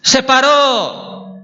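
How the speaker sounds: SE PARÓ high and drawn out; emphatic exclamation. Real, not acted. Preacher at the story's climax, emphatic exclamation. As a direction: proclaim "Se paró", stretching the last syllable.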